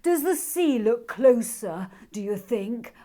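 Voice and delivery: wary, squinting at the sea; suspicious dragon. Acted. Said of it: "asked Sandra suspiciously".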